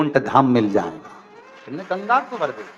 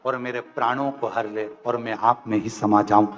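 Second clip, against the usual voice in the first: lower, softer humble plea; pleading demon; 6 semitones lower, 4 dB quieter than usual. Acted. Tarkasur humbly begs Shiva for death, low soft plea.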